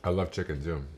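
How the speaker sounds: ordinary delivery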